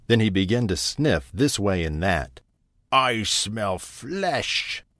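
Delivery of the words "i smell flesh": slow, menacing ogre voice; drawn-out hungry monster sniffing; dim slow booming giant. A narrator's reading. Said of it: "he said, looking around the room".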